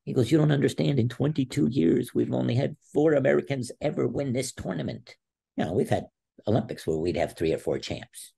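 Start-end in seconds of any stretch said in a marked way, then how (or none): 0.3-5.2 high-pitched imitation of a pleading coach; retold tearful plea. Wrestler imitating his coach's tearful plea, mildly emotional. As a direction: plead as a coach near tears, lightly acted. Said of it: "he's got tears in his eyes".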